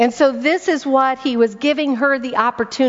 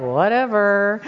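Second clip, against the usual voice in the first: dismissive eye-rolling "Whatever"; mock annoyed wife brushing off husband. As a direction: toss off "Whatever" with a roll of the eyes. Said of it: "I've rolled my eyes".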